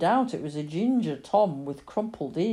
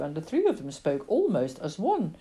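ordinary delivery

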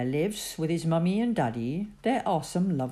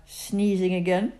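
ordinary delivery

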